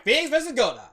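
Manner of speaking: announcing. Acted. Announcing the trial.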